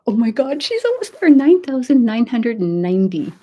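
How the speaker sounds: high, loud breathless thrill; almost there, real excitement; excited. Real, not acted. Host seeing the count nearly at ten thousand, excited.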